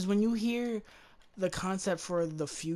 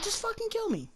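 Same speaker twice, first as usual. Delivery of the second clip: much higher, pleading and worn out; mock desperate plea; faster than usual. As Sarah Connor, begging the Terminator to end it, high desperate plea. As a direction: beg to be finished off, voice pitched way up. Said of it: "I'd be like".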